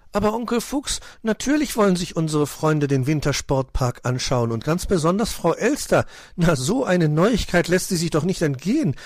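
high, animated little fellow; childlike, enthusiastic protest; eager young nephew. Acted. Nephew contradicts uncle eagerly.

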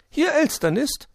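very high, loud magpie voice; high-pitched character voice, feigning tiredness; tired magpie. A narrator's reading. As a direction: answer the phone as a weary storybook magpie. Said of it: "meldete sich eine müde Stimme".

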